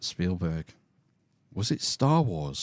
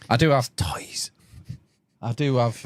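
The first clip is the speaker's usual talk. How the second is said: whisper; 3 semitones higher than usual. Real, not acted. Confessing toys, whispered aside.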